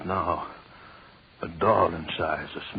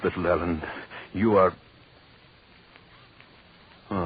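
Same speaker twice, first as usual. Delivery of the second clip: ordinary talk, nothing marked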